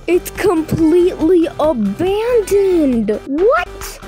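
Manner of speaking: high, drawn-out disbelief; incredulous. Pretend. Traveler finds the beach completely abandoned, incredulous.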